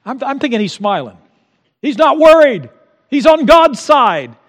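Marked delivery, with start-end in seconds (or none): 1.8-4.3 high, loud excitement; excited. Real, not acted. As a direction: burst out with joy. Excited about David's confidence.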